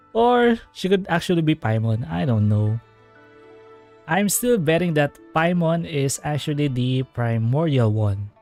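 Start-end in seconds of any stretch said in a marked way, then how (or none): none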